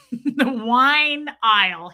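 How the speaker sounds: normal talking voice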